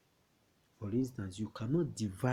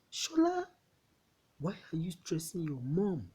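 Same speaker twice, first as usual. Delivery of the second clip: high-pitched exasperated telling-off; scolding call; 8 semitones higher, 3 dB louder than usual. Acted. Adult calls Shola out for stressing mom, scolding. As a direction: call the name high and loud, then scold.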